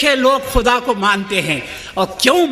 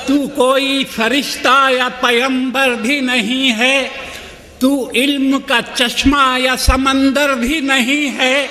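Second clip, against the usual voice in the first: measured, rhythmic mushaira delivery; stately nazm recitation; 4 semitones higher than usual. Acted. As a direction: recite each couplet with gravity.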